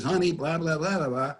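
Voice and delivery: singsong mock call from another room; wife calling out.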